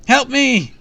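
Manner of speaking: high, loud cry for help; shrieking in terror; panicked scream. A narrator's reading. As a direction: scream like a trapped child. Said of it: "I heard the first scream".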